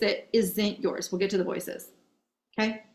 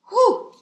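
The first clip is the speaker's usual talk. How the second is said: whew; 13 semitones higher, 5 dB louder than usual. Real, not acted. Blowing off excitement.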